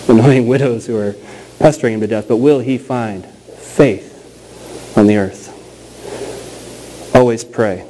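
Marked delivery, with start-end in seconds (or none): 2.3-5.5 slow, weighty preacher's question; preaching. Acted. Pastor to his congregation, slow and solemn.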